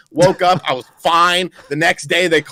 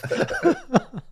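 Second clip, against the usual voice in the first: a soft, much higher burst of giggling; high giggle; 14 semitones higher, 6 dB quieter than usual. Real, not acted. Laughing at his own joke about the doctor, high giggle.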